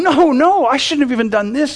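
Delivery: David pushing back against his men; protesting David. Acted. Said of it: "he's going".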